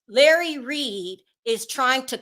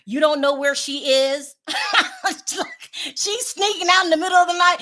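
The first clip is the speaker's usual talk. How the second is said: usual speaking voice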